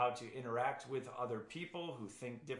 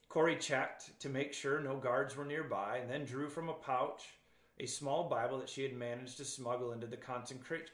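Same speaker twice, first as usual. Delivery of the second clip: ordinary talk, nothing marked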